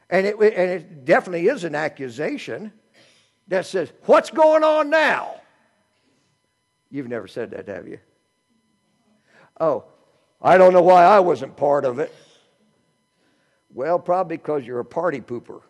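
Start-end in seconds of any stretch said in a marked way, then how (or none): 4.1-5.4 high, loud, slow demand; elder son confronting the celebration; indignant accusation. Retold or imitated. Elder son's accusing question. 9.6-12.1 loud, whiny grievance; resentful elder son sulking at the feast; sulky complaint. Retold or imitated.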